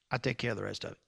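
very low, soft Kentucky drawl impression; Brother Morgan. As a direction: imitate a Kentuckian's hushed, deep drawl. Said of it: "in that Kentucky drawl".

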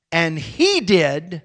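ordinary talk, nothing marked

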